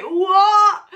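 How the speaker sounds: retold friends' stunned gasp; amazed "What?". Friends stunned that she guessed the Scorpio.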